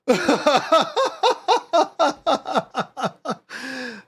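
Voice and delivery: high, rapid cackling laughter; long helpless laugh. Real, not acted. Player after a scare, helpless laughter. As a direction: laugh on and on until breathless.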